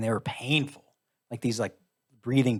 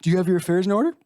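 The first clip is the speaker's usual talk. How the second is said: mock doctor; 7 semitones higher, 4 dB louder, much faster than usual. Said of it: "By the way, you're probably gonna die".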